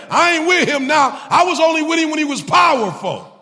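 high, loud, impassioned preaching; preaching at full force. Acted. Preacher to church, voicing Peter's fear, at full force. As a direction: drive it home to the congregation.

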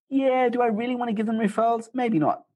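high-pitched, doubtful inner voice; mock reluctance. Weighing whether to refer a win-lose person, mock reluctant. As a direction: voice your hesitation in a high, drawn-back tone.

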